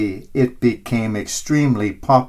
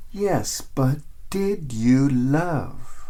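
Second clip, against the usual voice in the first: soft, slow and tender; gentle question; 6 dB quieter, much slower than usual. A narrator's reading. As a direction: ask it quietly, from the heart. Peter's gentle question again.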